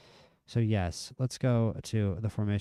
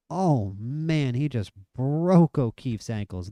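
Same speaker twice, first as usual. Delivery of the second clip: higher, louder amazed exclamation; excited; 4 semitones higher, 7 dB louder, slower than usual. Real, not acted. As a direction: marvel at the move out loud. Player beats defender, live reaction.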